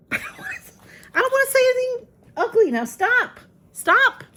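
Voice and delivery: high, loud exasperated scolding; scolding the cat. Real, not acted. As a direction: shriek at the cat to stop. Scolding cat messing with toys.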